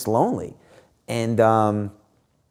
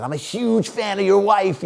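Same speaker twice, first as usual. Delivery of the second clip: much higher gushing fan voice; mimicking an excited female fan; 10 semitones higher than usual. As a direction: play the starstruck woman, up high. Imitating the woman fan.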